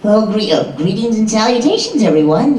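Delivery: put-on a polite nervous boy greeting everyone; Double D voice. Another Double D phrase, high prim greeting. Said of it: "for Double D".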